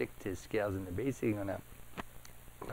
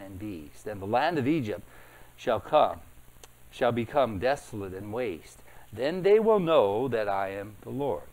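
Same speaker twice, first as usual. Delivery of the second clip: louder preaching voice, declaring God's words; loud scripture proclamation; 9 dB louder than usual. Acted. Preacher reading God's judgment on Egypt, loud and proclaiming. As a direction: thunder it out like a prophet.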